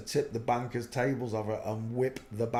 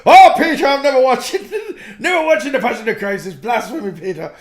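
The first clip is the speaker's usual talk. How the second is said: shrieking high and loud, laughing through it, in a put-on Irish lilt; mock Irish mum; 13 semitones higher, 18 dB louder than usual. Mum refusing to watch the film, high loud Irish voice. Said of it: "She was like".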